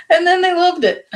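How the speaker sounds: high, loud burst of delight. Retold or imitated. Teacher recalling happy clients, high and excited. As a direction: gush about how they loved it.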